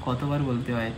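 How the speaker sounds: ordinary delivery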